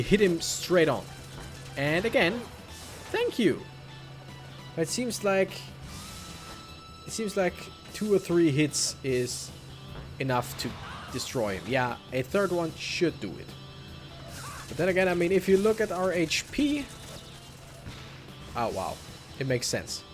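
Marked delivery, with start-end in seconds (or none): none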